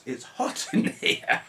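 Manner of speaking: real laugh breaking through, high and slow; breathy laughing repeat of it's hot. Real, not acted. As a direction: laugh as you say it again.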